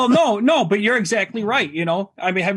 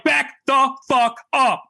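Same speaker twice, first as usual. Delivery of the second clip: slow, punched-out retold threat, raised pitch; retold warning; 4 semitones higher, 4 dB louder, slower than usual. Parent retelling the standoff in Walmart, slow forceful warning. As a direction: drive each word home slowly.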